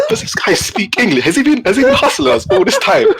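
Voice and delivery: high-pitched incredulous retold reaction; comically scandalised, pitch shooting up; stunned disbelief. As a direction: squeal the questions, astonished at being fooled. Retelling his stunned inner reaction.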